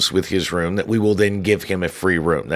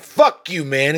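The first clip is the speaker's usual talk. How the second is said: mock hostile; 7 semitones higher, slower than usual. Litterer cursing back at the supervisor, hostile. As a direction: throw it back at him with attitude.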